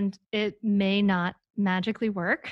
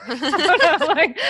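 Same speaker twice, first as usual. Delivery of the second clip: laughing; 8 semitones higher, 7 dB louder than usual. Real, not acted.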